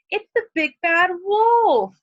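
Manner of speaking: high, loud, slow warning about the wolf; frightened exclamation. Shown as a demonstration. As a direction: sound the alarm like a scared piglet. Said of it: "more power to it".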